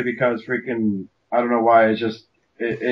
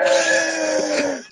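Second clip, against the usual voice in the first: mock anguished wail; much slower than usual. Echoing the mock anguished cry.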